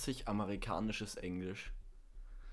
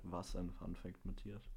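sarkastisch; 3 semitones lower, 10 dB quieter than usual. Retold or imitated. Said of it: "Zuschauer hat sich grad gedacht".

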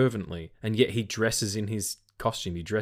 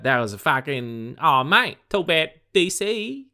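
hammed-up Aussie accent, pitched well higher; Australian accent; 10 semitones higher, 4 dB louder than usual. Cohost riffing on a DC video game, in a hammed-up Australian accent. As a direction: lay the Australian on thick, mate-heavy and high. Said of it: "so my Australian accent comes out".

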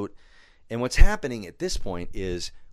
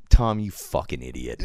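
mock friend's scorn; 13 semitones lower than usual. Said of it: "He's like".

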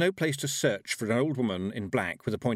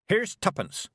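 curt Granny; 10 semitones higher, 3 dB louder, slower than usual. Acted. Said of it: "said Granny Weatherwax".

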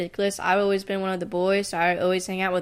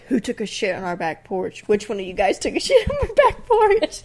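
mock outraged frat guys. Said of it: "the other fraternity was like".

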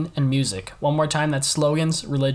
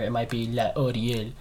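lightly put-on French accent, a bit lower; 4 semitones lower, 5 dB quieter than usual. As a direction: say the brand the French way, half-joking. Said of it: "That's a pretty good French accent".

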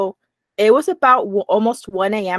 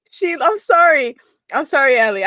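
real laughter breaking through an apology; giggling helplessly while saying sorry; laughing apologetically; 4 semitones higher than usual. Real, not acted. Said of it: "I just keep laughing".

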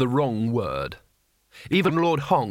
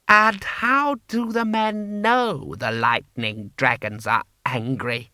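haughty lord. Acted. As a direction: look down on them, slowly. Lord Hong, cold and disdainful.

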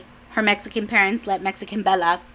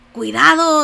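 warning dad; 7 semitones higher, 7 dB louder than usual. Retold or imitated. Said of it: "be like".

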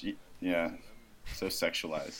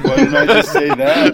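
laughing; 12 semitones higher, 11 dB louder than usual. Retold or imitated. Laughing through retold quote.

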